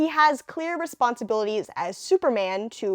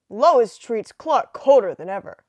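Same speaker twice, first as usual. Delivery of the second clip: 1950s narrator; 8 dB louder than usual. A narrator's reading. Reads comic narration.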